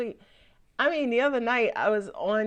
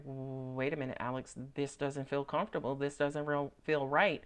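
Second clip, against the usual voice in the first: soft, uneasy mutter to yourself; quietly uneasy, like something feels off; stressed inner voice; 7 semitones lower, 6 dB quieter than usual. Retold or imitated. Stressed Scorpio quietly telling Alex something feels off, uneasy. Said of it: "you're stressed out. You're like".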